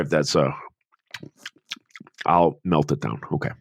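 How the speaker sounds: chewing ice while talking; mouth full. Real, not acted. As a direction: talk around an ice cube in the mouth. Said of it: "I'm chewing on an ice cube".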